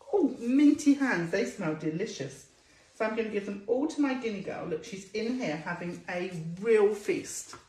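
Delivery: ordinary talk, nothing marked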